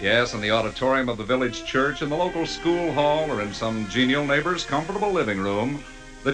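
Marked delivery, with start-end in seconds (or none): none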